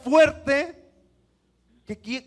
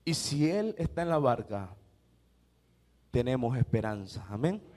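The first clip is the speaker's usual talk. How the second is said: lower, softer, tender assurance; hushed hope; 12 semitones lower, 5 dB quieter than usual. Acted. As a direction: let it land softly. Susurro tras el clímax. (esperanza dicha en voz baja y tierna)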